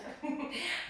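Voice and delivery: short breathy giggle; small amused chuckle; light laugh. Real, not acted. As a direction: giggle briefly mid-instruction. Amused during balance instruction.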